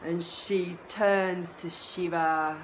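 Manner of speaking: ordinary delivery, nothing marked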